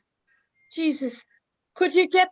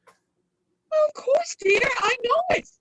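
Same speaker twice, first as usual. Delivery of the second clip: tearful insistence; 13 semitones higher, slower than usual. Acted. Mother insists, near tears.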